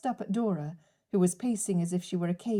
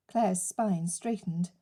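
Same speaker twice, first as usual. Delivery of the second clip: plain reading voice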